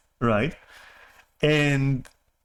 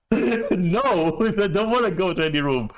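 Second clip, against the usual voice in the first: 1 laughing refusal; 4 semitones higher than usual. Retold or imitated. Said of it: "And I'm like".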